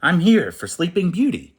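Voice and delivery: high, loud royal announcement; a snooty prince proclaiming himself; grand declaration. Acted. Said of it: "Prince Max declared".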